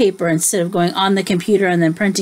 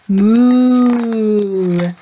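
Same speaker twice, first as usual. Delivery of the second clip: mooing cow. Acted. Reading cow sound aloud.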